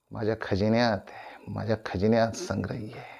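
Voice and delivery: soft, intimate, sinking to a whisper; hushed savouring. Acted. Actor telling of his treasury of words, hushed and soft. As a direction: drop the voice right down, ending whispered.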